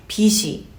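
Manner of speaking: 빚이 mispronounced with a hissed "shi" for "ji"; wrong pronunciation. Shown as a demonstration. Teacher imitates many people's mistake, wrong pronunciation. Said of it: "this is the wrong way".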